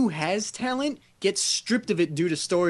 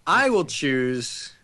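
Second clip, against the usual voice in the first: mock solemn; 7 semitones lower, slower than usual.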